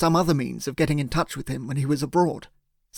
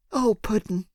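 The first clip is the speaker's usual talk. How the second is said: tender; 6 semitones higher than usual. Acted. Emily squeezing her partner, tenderly comforting him.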